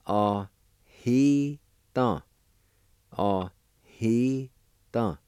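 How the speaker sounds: Ah, he done drawn out slowly, stressing he; slow Cherokee demo. Shown as a demonstration. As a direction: slowly model Ah he done for the class. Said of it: "It's pronounced like this".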